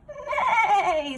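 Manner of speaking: high laughing whinny; whinny it high like a horse; horse neigh. Acted. Horse answers Duck.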